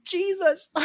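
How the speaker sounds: high, loud mock shriek of Jesus; hammed-up high yelp; imitated scream. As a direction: shriek it in shock at the stabbing. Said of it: "you scream".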